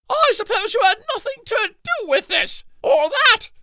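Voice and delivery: high furious shout. Acted. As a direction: yell the accusation. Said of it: "Archibaldina shouted".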